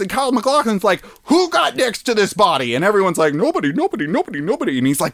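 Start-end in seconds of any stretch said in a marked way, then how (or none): 1.2-2.7 high-pitched agent demanding answers; lightly acted alarm, pitched up; mock urgent demand. 3.3-4.8 high singsong repeated denials; mock crowd chorus. Everyone answers, retold.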